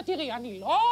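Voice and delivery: usual speaking voice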